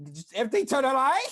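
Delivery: laughing at the memory; recounting an awkward exchange and cracking up; laughing question. Real, not acted. Retelling it laughing.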